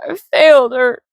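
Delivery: high, tearful catch in the voice; choking up. A narrator's reading. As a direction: choke on the words, near crying. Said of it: "choking up".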